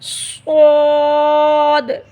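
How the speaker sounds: स्वाद said slow and thick with a full heavy s; heavy letter. Shown as a demonstration. Teacher shows students the heavy letter, slow and thick. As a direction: draw स्वाद out with heavy emphasis. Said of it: "इसको हम मोटा पढ़ेंगे".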